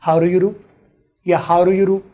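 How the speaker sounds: How रू यू रू with d flapped to r, American accent; fast American run of How रू यू रू; American English. Shown as a demonstration. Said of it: "अब मैं इसके दो हिस्से बना लेता हूँ".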